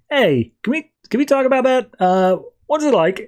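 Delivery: retold high, hesitant asking; tentative question. Tiffany tentatively asks about secret identity.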